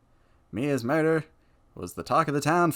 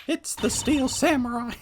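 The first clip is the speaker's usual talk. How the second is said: higher put-on Maya voice; 10 semitones higher than usual. Maya bursting in on Nick, higher put-on voice. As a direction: pitch it up as the excited girl. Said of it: "I don't know why I've gone to that voice".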